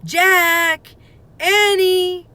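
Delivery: high, loud call from far away; distant cry. A narrator's reading. Mother calling from far off, loud distant call. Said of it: "came a distant cry again".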